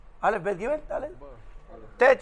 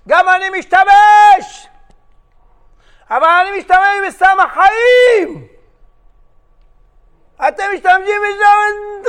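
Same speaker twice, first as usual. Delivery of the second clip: higher, louder and slow, every word stretched; slow booming preaching; 10 semitones higher, 7 dB louder, slower than usual. Retold or imitated. Rabbi preaches to young prisoners, booming slowly. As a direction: proclaim it to the whole hall.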